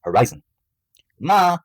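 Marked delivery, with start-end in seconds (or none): 1.2-1.6 high, loud call to Ma; yell. A narrator's reading. Son calling to his mom on the way out, yelling. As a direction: holler out for mom. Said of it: "Bradley yelled as he was making".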